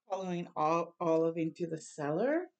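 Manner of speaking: ordinary delivery, nothing marked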